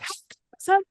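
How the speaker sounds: put-on high childlike voice asking; kids asking. Teacher's pupils asking how it went, high and eager.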